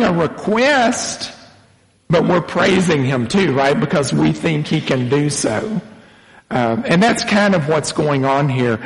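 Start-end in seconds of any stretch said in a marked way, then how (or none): none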